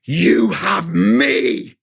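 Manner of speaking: slow, gruff shouted growl; Clint Eastwood growl. Retold or imitated. God answering the moaning speaker, slow gruff growl. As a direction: growl it back, slow and commanding.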